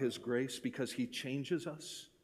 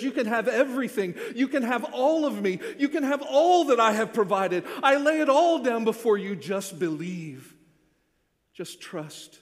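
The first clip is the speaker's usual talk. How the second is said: louder and higher, swelling, then slowing on just believe; joyful Jesus offer; 9 semitones higher, 11 dB louder than usual. Pretend. Preacher voices Jesus offering everything, rising joy then gentle. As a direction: pour it out like a generous gift.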